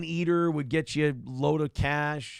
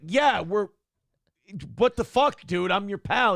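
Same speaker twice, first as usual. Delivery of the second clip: higher, louder aggrieved outburst; hammed-up outrage at a betrayal; indignant protest; 5 semitones higher, 5 dB louder than usual. Acted quarrel, [name] confronting [name].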